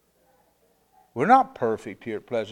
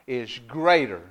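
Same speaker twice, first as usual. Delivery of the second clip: full-force preaching; 2 semitones higher, 8 dB louder than usual. Acted. Pastor proclaiming victory to the church, loud. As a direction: lift the church with it.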